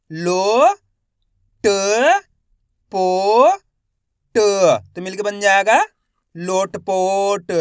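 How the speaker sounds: slow spelling drill, each syllable held with a rising end; stretched syllables for beginning readers; letter-by-letter sounding out. Shown as a demonstration. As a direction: sound out each letter, then blend the word. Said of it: "एक अक्षर का उच्चारण करके".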